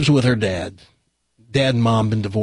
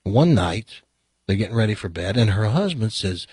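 ordinary delivery

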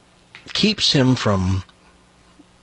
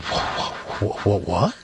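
stuttering shock; 3 semitones lower, 6 dB quieter, slower than usual. Acted. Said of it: "stuttering problem".

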